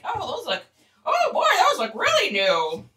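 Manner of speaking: a light high cartoon mouse voice by accident; Mickey Mouse-ish. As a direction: slip into a squeaky mouse voice. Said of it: "I just sounded like Mickey Mouse".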